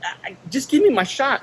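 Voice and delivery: high-pitched exasperated protest; retold outburst of disbelief, pitched well up; getting upset. Patient arguing with clinic staff over his shot, getting upset. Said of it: "I started getting upset".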